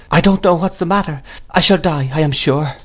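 put-on high, plaintive young mother; faltering. A narrator's reading. Mother fears she shall die, faltering. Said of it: "faltered my mother".

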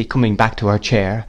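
plain reading voice

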